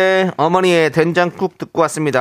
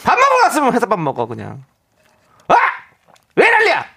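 angry; 6 semitones higher than usual. Acted. Reading angry listener message.

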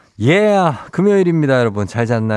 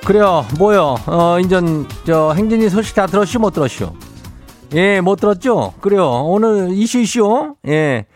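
Chungcheong dialect; 6 semitones higher than usual. Acted. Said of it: "행진리 이장인데요".

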